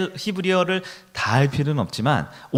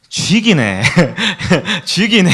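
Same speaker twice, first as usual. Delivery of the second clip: laughing Gyeongsang 쥐기네, a bit louder; hammed-up Gyeongsang dialect exclamation; 2 semitones higher, 6 dB louder, slower than usual. 경상도 사람 흉내를 내는 목사, 웃으며 경상도 사투리. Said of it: "경상도 분들은".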